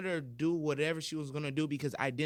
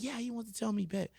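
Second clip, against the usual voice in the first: mocked teacher; 9 semitones higher than usual. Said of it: "He was like".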